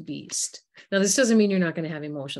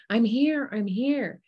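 mild mock yell; 6 semitones higher, slower than usual. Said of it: "someone's yelling".

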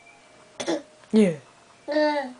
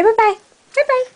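sing-song bye-bye; 9 semitones higher, 3 dB louder, faster than usual. Real, not acted. High sing-song goodbye.

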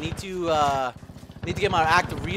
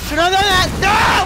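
yelled high and loud in rage; furious shout; 12 semitones higher, 10 dB louder than usual. Real, not acted. Gamer dying in match, screaming in real rage.

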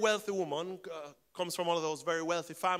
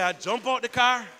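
excited retelling; 5 semitones higher, 6 dB louder than usual. Retold or imitated. Woman joyfully retelling rain miracle.

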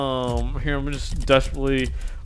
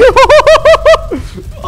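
high, loud burst of laughter; shrieking laugh; 9 dB louder than usual. Real, not acted. Laughing at the chaos.

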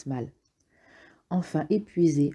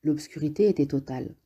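plain reading voice

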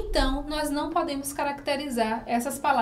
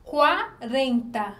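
pronúncia lenta; 4 dB louder, much slower than usual. Shown as a demonstration. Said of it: "conseguimos pronunciar a letra U".

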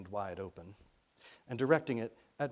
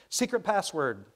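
high, loud, slow radio call; raised young woman's voice; 7 semitones higher, 9 dB louder, slower than usual. A narrator's reading. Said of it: "sound of a young woman's voice".

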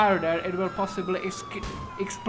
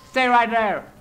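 loud order to stay put; a cop yelling at a suspect to freeze; firm command; 4 semitones higher, 8 dB louder than usual. Acted. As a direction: bark it at the suspect.

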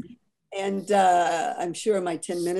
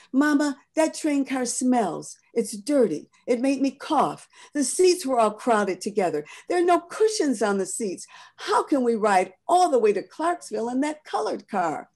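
put-on young girl's voice, higher, whining about the dirty car; a child pleading with mama, pitched up; complaining girl; 6 semitones higher than usual. Patsy begging her mother not to ride there, high and whiny.

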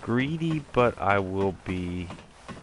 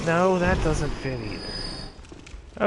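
theatrical voice; 7 semitones higher, much slower than usual. Acted. Slow stage voice, self-correcting.

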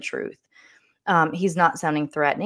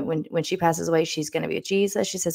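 ordinary talk

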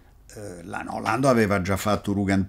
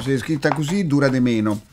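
normal talking voice

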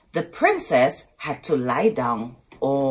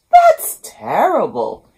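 king voice; 4 semitones higher, 10 dB louder than usual. Acted. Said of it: "said the king".

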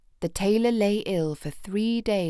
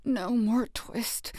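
slow, sleep-talking mumble, a touch softer; slow delirious tailor mumbling in his sleep; feverish mumble; 2 semitones higher, 4 dB quieter, slower than usual. A narrator's reading. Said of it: "still in his dreams he mumbled".

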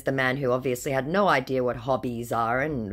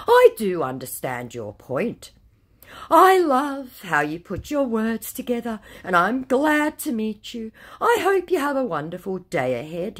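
higher, louder syrupy flattery; mock flattering scammer; 9 semitones higher, 5 dB louder than usual. Scammer flattering her in chat, hammed-up syrupy. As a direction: lay the fake charm on thick.

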